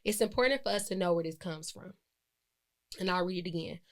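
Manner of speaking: normal talking voice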